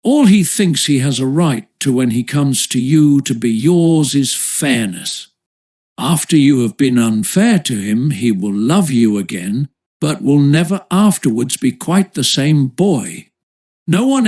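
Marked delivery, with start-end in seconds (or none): none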